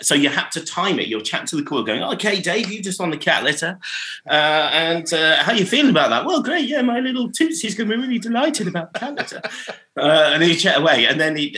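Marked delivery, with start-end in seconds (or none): 2.0-6.2 higher, bright presenter patter; cheery DJ. Retold or imitated. Mock DJ asking the caller how it feels. 6.3-9.4 much higher, fast put-on caller. Mock caller gushing about the cat's prize.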